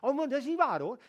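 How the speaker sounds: high, loud put-on protest; mock false modesty. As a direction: overdo the humble denial. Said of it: "om te zeggen".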